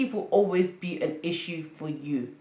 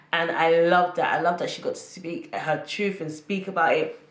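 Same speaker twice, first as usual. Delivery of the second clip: usual speaking voice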